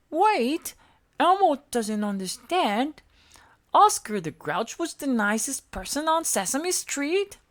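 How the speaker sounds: small eager monster voice; Elmo voice. A narrator's reading. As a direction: squeak it up like Elmo.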